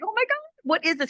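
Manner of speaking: high, quick burst of astonishment; amazed. Retold or imitated. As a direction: marvel at it in a rush. Retells amazement as pain vanished.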